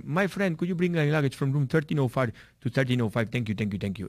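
imitating an Indian English accent, friendly request; a Sikh guest asks the porter in Indian-accented English. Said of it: "Ellos hablan el inglés con acento hindi". (imitando el acento indio al pedir las maletas)

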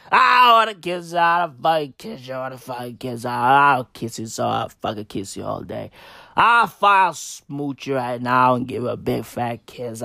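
put-on low, rambling drunk uncle voice. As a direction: slur it like a tipsy uncle at a party. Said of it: "Donald Trump is a lunatic".

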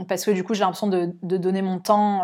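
usual speaking voice

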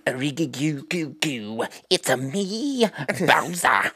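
silly mock Bowser voice. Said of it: "He'll be like".

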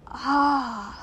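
a drawn-out, mildly melancholy sigh of a word; rather melancholy. A narrator's reading. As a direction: let out a wistful exclamation. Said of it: "in a rather melancholy voice".

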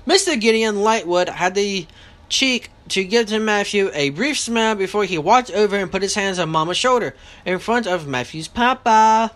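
plain reading voice

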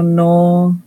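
ordinary talk, nothing marked

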